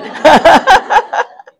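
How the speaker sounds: loud, high burst of laughter; big amused belly laugh; hearty laugh. Real, not acted. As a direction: burst out laughing at your own joke. Laughs at own joke.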